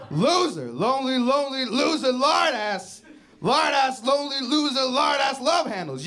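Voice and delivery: spiral into a frantic self-berating chant; hammed-up meltdown while writing; unravelling self-insults. Handwriting practice turns self-hating outburst.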